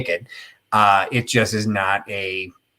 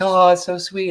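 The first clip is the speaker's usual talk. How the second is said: high, cooing imitation of fans; mock gushing; 9 semitones higher than usual. Audience gushing over Cinderella, mock gushing. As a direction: coo it in a higher voice, mocking.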